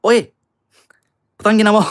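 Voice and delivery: put-on loud, high Filipino accent swear. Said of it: "But I can do the accent".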